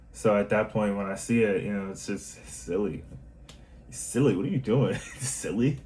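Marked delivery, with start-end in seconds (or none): 3.9-5.8 playful teasing. Retold or imitated. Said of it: "that's literally what I say now".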